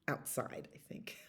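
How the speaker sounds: breathy unvoiced aside; tossed-off whisper. Real, not acted. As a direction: whisper the word. Aside said softly.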